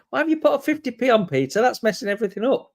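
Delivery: high, rapid grumble of complaint; mock exasperation. Real, not acted. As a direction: tease the bidder with a quick, high-pitched moan. Mock complaint at an odd bid.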